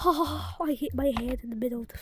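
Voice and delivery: fast breathy whisper. Real, not acted. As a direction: rush it in a whisper. Hushed aside.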